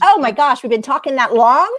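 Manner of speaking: real disbelief at how time flew; surprised. Real, not acted. Guest surprised at the host's break call, loud amazement. Told they've talked 25 minutes.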